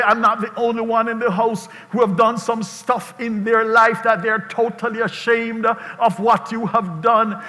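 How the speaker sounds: ordinary talk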